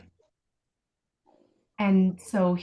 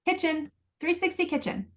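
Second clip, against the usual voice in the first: sing-song cue; 9 semitones higher than usual. Shown as a demonstration. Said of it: "all I say is".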